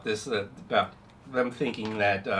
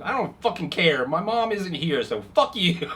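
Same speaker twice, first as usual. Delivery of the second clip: put-on defiant little girl; 7 semitones higher, 6 dB louder than usual. Child NPC swearing defiantly.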